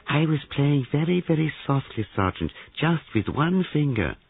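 high, lilting character voice; airy, teasing suspect; sly Mr. Palavicini. A narrator's reading. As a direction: answer lightly in a high, put-on voice.

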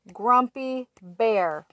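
ordinary delivery, nothing marked

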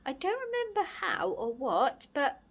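high, halting, wavering recollection; quivering, breaking voice. Acted. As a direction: falter through it, close to tears. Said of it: "his voice began to quiver and break".

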